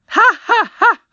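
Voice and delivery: shrill triumphant cackle, very high; triumphant laugh. A narrator's reading. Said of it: "shouted the queen triumphantly".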